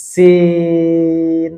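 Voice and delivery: "সিন" with a very long, level ee; long alif stretch. Shown as a demonstration. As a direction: hold the vowel of "সিন" on one steady note. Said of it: "চার আলিফ টেনে বলবো".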